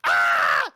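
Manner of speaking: loud low yell running off; mock scream. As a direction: shout as he storms away. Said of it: "And Bebo's like".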